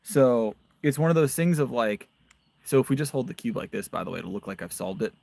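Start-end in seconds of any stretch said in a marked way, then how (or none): none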